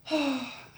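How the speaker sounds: real, audible sigh; heavy sigh. Real, not acted. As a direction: let out a big breath. Heavy pause in breakup reflection.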